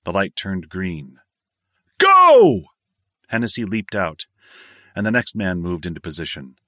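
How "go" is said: high, loud sharp shout; shouted command. A narrator's reading. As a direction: bark the jump order. Jump order given.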